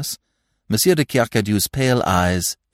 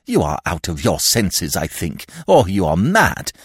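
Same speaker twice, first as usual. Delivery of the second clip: indignant; 13 semitones higher, 6 dB louder than usual. A narrator's reading. Horrified godfather rebukes André.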